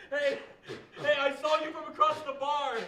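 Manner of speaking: loud bar voice, calling out; shouting across bar. Acted. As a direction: yell it across a noisy bar.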